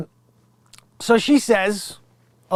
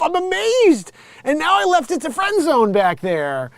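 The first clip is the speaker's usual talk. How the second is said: high, loud mocking voice; mock smug mother; 11 semitones higher, 4 dB louder than usual. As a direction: gush with fake pride.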